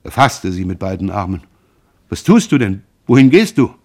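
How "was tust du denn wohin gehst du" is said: alarmed. A narrator's reading. Son grabs mother leaving.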